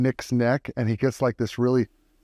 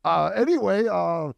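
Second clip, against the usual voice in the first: 1 casual high voice; 8 semitones higher than usual. Acted. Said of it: "he's like".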